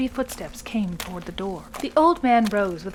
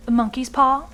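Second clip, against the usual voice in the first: curious; 4 semitones higher, 6 dB louder than usual. A narrator's reading.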